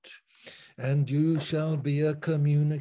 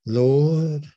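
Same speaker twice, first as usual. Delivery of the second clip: calling out; 8 dB louder than usual. Retold or imitated. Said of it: "Peter actually said".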